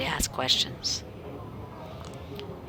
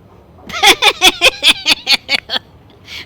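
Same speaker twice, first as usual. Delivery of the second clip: high, loud burst of laughter; 15 dB louder than usual. Real, not acted. Big laugh.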